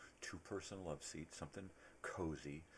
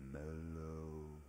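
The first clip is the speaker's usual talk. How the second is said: drawn-out mellow, then soft check-in; soothing stoner, stretched out and easy; Chong; 2 semitones higher, 3 dB quieter than usual. Acted. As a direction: lull him gently with long vowels. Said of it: "And Chong is like".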